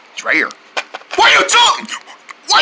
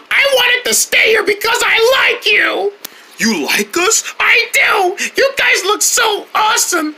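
ordinary delivery

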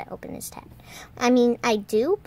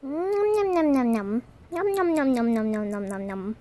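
cartoon munching noises, gliding down in pitch; play-eat with rapid nom-noms; make-believe chomping sound; pretend munching. Toy character eating.

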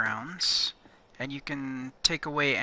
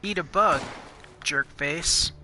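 taunting; 4 dB louder than usual. Real, not acted. Insulting an enemy in the game.

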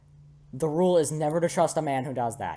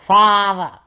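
high, loud cry for father; hammed-up desperate call; acted shout; 6 semitones higher, 14 dB louder than usual. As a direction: yell out in character. Voicing character screaming for father.

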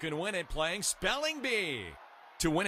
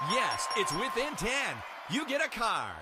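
excited win; 6 semitones higher than usual. Acted. Win announced in game.

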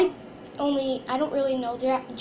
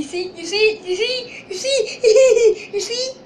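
high, whimpering crybaby imitation; mock crying; 8 semitones higher, 5 dB louder, slower than usual. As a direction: squeal it through fake sobs, higher and higher. Mimics the crying kid showing bruise.

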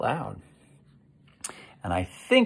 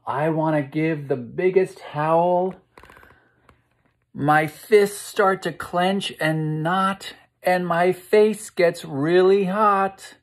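slow, higher and louder verse building up; dramatic rhyme reading; 7 semitones higher, 5 dB louder, slower than usual. A narrator's reading. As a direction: swell with the lion's anger. Reads the angry lion verse.

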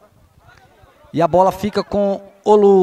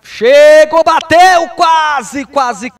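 high, loud near-miss call; excited shout; 5 semitones higher, 7 dB louder, much slower than usual. Real, not acted.

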